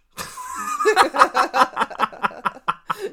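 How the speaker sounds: big squealing laugh; loud high laugh. Real, not acted. Laughing at own dream.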